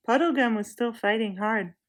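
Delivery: ordinary delivery, nothing marked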